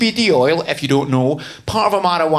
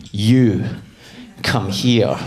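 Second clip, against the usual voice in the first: put-on low, halting command in own accent; security guard; 7 semitones lower, much slower than usual. Airport guard ordering a traveller over, low imitated command. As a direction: summon someone with a low order. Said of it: "an Abu Dhabi security guard pointed at me".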